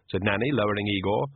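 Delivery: ordinary delivery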